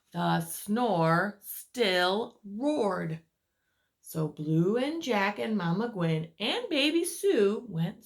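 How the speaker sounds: lively read-aloud voice for little ones; storyteller reading to children; story narration. A narrator's reading. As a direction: tell it like a bedtime picture book. Reads picture book aloud.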